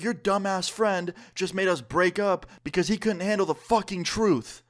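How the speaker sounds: high-pitched mock girlfriend rant; angry accusation. Girlfriend blames him to his friend, angry accusation. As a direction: imitate her indignant outburst, drawing out the last words.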